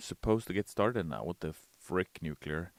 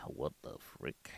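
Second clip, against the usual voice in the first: whispered, much softer; shocked whisper; 7 semitones lower, 12 dB quieter, slower than usual. Real, not acted. Streamer reacting to a weird message, whispering. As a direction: breathe it out in disbelief.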